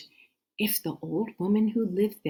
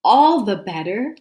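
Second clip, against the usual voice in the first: loud, higher pounce; wolf's cry; 7 semitones higher, 11 dB louder than usual. Acted. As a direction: burst out to catch her. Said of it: "cried the wolf".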